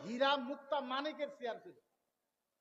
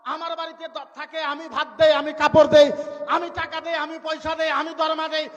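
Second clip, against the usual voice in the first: louder, higher angry tirade; Pharaoh's fury; 4 semitones higher, 10 dB louder than usual. Acted. Pharaoh rages about the maid who lives off him, shouting. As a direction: rage at the ungrateful servant girl. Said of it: "রাগে টেমা মাথা সব গরম".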